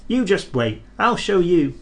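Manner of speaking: defiant little engine. Acted. Thomas defiant.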